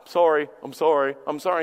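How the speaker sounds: low, repetitive throwaway sorry; mock apology. Sinner to God without meaning to quit, mock apology. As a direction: toss off empty apologies. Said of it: "that's what we do with God".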